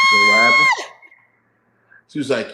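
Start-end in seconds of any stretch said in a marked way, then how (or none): 0.1-0.7 much higher, much louder yelp; startled shout; sudden shout. Real, not acted. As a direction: burst out to grab everyone's attention. Sudden outburst in story.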